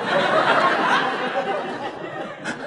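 helpless airy giggle rising high; high breathless laugh. Real, not acted. Preacher losing it at his own joke, high breathy laugh. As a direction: burst out laughing, no voice. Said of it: "I couldn't say it without laughing".

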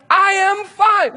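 loud, high, drawn-out protest; angry insistence. Retold or imitated. Driver fed up with friends asking if he's fine, angry shout. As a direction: shout each word slowly, hugely exasperated. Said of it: "I am so angry about it".

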